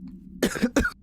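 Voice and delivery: choking cough on water; real coughing fit. Real, not acted. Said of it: "went down the wrong pipe".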